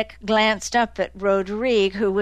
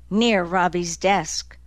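ordinary delivery

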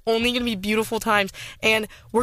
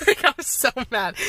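laughing exasperation; 2 semitones higher than usual. Real, not acted.

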